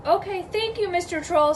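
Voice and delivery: high little goat voice; small character, pitched high. Acted. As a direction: lift it up like a small, grateful kid goat. Little goat thanks the troll.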